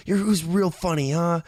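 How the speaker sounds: high-pitched huffy imitation; mock irate sarcasm. Woman giving up on Jake and leaving, mock irate. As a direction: imitate her jeering brush-off.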